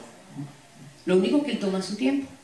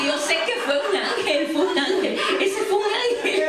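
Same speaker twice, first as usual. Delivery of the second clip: laughing through the words, rising; gleeful quote, chuckles in it; retelling a brother's delighted claim with laughter; 8 semitones higher than usual. Retold or imitated. Brother's joyful angel claim, laughing.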